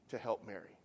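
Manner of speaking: normal talking voice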